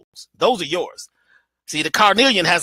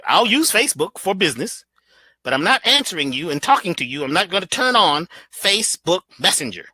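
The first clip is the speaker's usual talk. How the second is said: ordinary delivery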